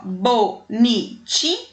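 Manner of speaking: "Bonitinhas" broken slowly into bo-ni-ti-nhas for counting; slow syllable by syllable. Shown as a demonstration. Teacher counting syllables of "Bonitinhas" for pupils, slow and separated. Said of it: "Quantidade de número de sílabas".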